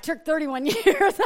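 laughing. Real, not acted. Joking to the congregation about her husband, laughing. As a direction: laugh as you admit how long it took.

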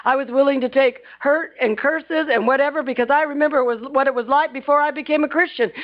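ordinary talk

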